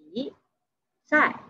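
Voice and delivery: "Bisai" slowly, a long pause between "Bi" and "sai"; syllable by syllable. Shown as a demonstration. Teacher breaking down "Bisai" for learners, syllable by syllable. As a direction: split "Bisai" into two separate syllables.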